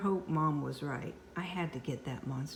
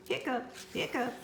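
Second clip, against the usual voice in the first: hiccups; 8 semitones higher than usual. Acted. Monster hiccups.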